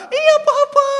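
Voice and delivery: put-on very high, loud little boy; small child's voice. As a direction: squeal it as a dangling toddler. Son answers while dangling.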